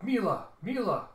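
loud, high calls, each falling away; calling after her. Acted. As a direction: call out sharply to a daughter walking off. Father calls after departing daughter.

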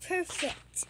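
ordinary delivery

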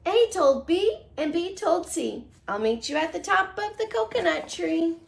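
lively storybook read-aloud; reading to children. Acted. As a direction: read it like a bedtime picture book. Reads picture book to children.

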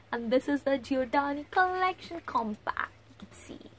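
unvoiced, dropping into a whisper; hushed reveal. Real, not acted. Vlogger unveiling a compact to viewers, hushed whisper. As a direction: let it sink to a whisper.

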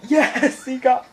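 ordinary delivery

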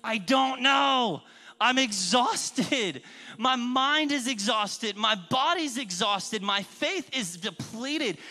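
acted-out loud, drawn-out despair; tearful outburst. Exhausted husband crying to his wife, mock tearful outburst. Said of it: "I'm just crying to my wife".

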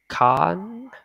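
"contract" with drawn-out, rising KAAN, then trakt; long stressed vowel. Shown as a demonstration. Teacher demonstrates the American accent, long high AH in "contract". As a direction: stretch and lift the first syllable of "contract". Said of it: "hai chấm là kéo dài rồi".

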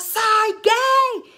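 high, loud shout; mock proud shout. Mother imitating men on stage, high loud proud yell. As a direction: yell it triumphantly. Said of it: "y gritaban".

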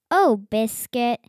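plain reading voice

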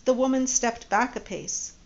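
ordinary delivery, nothing marked

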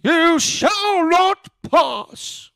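high, very slow wizard bellow; Gandalf. Shown as a demonstration. Retrying the wizard for co-hosts, high drawn-out imitation. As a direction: draw out a mock wizard's command. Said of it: "too young of a Gandalf".

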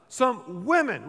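slow, weighty Some women; the preacher, emphasizing that it was women; emphasis on women. Acted. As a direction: stretch and stress women. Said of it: "let me emphasize this".

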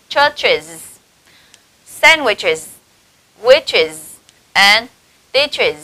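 "churches, sandwiches, witches and ditches", each ending in -iz; spaced model reading of "churches, sandwiches, witches and ditches"; IS endings after CH. Shown as a demonstration. Teacher modelling ch-words, "churches, sandwiches, witches and ditches" with clear -iz. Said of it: "chúng ta sẽ có ở đây là".

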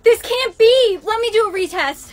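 distraught pleading. Acted. Student begs teacher for a retest, distraught. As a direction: beg loudly for another chance. Pleading after failed exam.

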